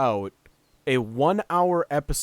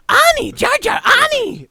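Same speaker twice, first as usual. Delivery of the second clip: very high, loud squeaky call; shrill cartoon shout of Ani; high shouting character voice; 10 dB louder than usual. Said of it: "one of the best lines".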